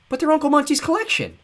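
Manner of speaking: high, loud protest; crying out. A narrator's reading. Klaus protesting the reptiles being taken, high and loud. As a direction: cry out in dismay. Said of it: "Klaus cried".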